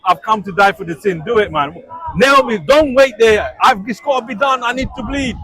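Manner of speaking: mock bold Jesus begging for the cross; bold, eager Jesus. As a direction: play Jesus impatient to be nailed. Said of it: "You should have been bold about it".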